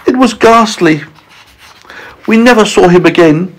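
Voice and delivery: high-pitched, appalled spider; high, theatrical dismay; bereaved Miss Spider. Acted. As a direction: lament in a high storybook voice. Spider recalls losing her father.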